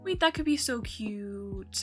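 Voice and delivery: ordinary delivery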